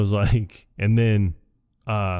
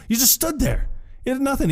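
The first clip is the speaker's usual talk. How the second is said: squeaky scolding voice, much higher; shrill accusing character voice, pitched way up; high-pitched mock scolding. Friends retelling movie scene, angry coach.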